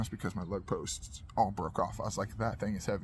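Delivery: low, soft, careful aside; retold in a hushed, deferential voice; tactful warning. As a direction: gently caution a busy man without pushing. Retells tactfully warning the wrecker.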